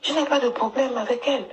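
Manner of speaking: ordinary talk, nothing marked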